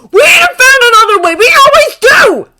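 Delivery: high, very loud, tearful insistence; anguished shouting. A narrator's reading. Draco insisting to Harry, tearful anguished shouting. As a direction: scream it, near sobbing. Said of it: "Draco shouted".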